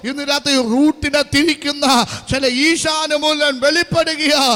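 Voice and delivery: loud prophecy rising in pitch; full-force preaching. Real, not acted. Preacher declaring a turned route over the congregation, loud fervent preaching.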